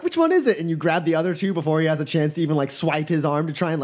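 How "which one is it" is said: high-pitched guessing voice; questioning customer. Acted. Customer challenged to pick a cup, high questioning. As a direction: ask it high and quick to fall. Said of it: "and you go like".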